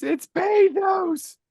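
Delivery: high, slow mock shout; hammed-up pathos shout. Shouting the joke to his co-hosts in a high, slow hammed-up wail. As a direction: belt it out like a ham tragedian.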